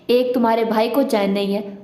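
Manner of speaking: ordinary delivery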